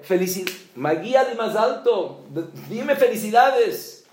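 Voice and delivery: loud, high, jubilant demand for congratulations; excited shout. Retold or imitated. New father shouting to a friend, overjoyed and loud. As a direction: call out overjoyed, voice leaping up.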